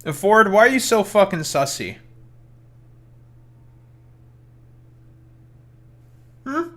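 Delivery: teasing. Pretend. Teasing Ford on stream.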